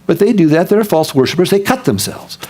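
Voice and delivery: usual speaking voice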